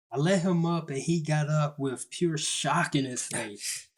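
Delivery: ordinary delivery, nothing marked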